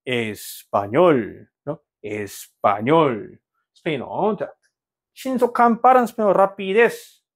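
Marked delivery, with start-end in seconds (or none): 0.1-1.5 "에스파뇰" very slow and low, 뇰 stressed; stress on final syllable. Shown as a demonstration. Teacher repeats the model for class, "에스파뇰" stressed on 뇰. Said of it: "바로 직전에 있는 음절의 음정을 낮춰라". 2.0-3.4 "에스파뇰" very slow and low, 뇰 stressed; stress on final syllable. Shown as a demonstration. Teacher repeats the model for class, "에스파뇰" stressed on 뇰. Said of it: "바로 직전에 있는 음절의 음정을 낮춰라".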